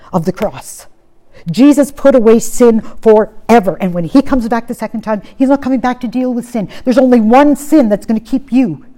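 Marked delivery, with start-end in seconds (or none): 1.5-8.9 loud, high, impassioned preaching; fervent preaching. Acted. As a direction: preach it at full force to the congregation. Preacher rising again.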